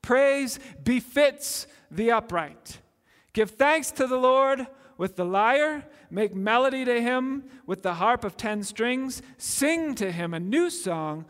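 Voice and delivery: ringing scripture read from the pulpit; proclaimed psalm reading. Acted. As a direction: call the verses out like a summons to praise. Preacher reads psalm call to joy.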